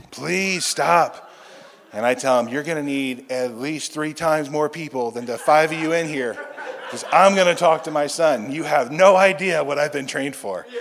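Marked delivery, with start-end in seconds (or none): 0.1-1.3 higher, louder acted plea; urgent plea. Techs beg patient to stay on the table, urgent. 2.5-10.7 loud, higher-pitched mock threat; defiant retort. Patient warns the five staff holding him, loudly defiant.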